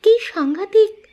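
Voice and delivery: high-pitched horrified exclamation; high, startled gasp of words; shocked. Acted. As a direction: react aghast to a frightening tale. Shocked reaction to the deadly tale.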